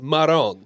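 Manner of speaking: marron, Madonna shortened to end on a stressed "rone"; "marron" with the last vowel dropped; Italian-American clipped pronunciation. Shown as a demonstration. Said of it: "they pronounce it marron".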